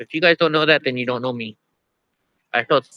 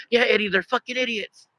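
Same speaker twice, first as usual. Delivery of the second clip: higher, softer imitation of a companion chiming in; Johnny; 7 semitones higher, 5 dB quieter than usual. Companion behind agrees with the fed-up dog owner, high soft mimicry. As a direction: echo it from behind in a high, small voice. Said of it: "Johnny behind me is like".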